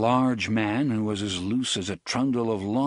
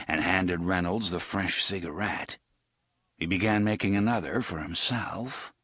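usual speaking voice

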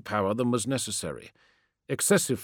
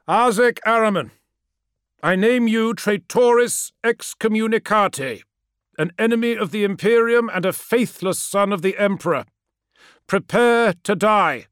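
loud proclamation; 11 semitones higher, 7 dB louder than usual. Acted. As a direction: pronounce the sentence like a judge. Formal denunciation in arena.

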